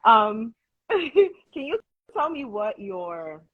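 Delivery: breaking into laughter mid-question, slow; laughing. Real, not acted. Host laughing while asking her guest a question. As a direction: laugh through the words as you ask.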